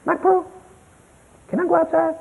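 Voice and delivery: put-on high, soft child's asking voice; little girl asking grandpa permission, high and soft; sweet little girl. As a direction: ask politely like a tamed toddler. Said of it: "That's what she calls me".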